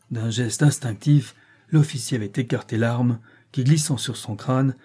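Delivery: plain reading voice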